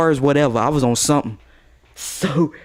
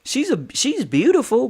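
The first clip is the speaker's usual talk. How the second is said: mock girl voice; 9 semitones higher than usual. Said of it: "She goes".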